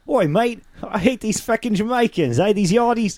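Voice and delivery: put-on high, loud Australian accent. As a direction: shout it like a rowdy Australian bloke. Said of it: "came out with Australian accent".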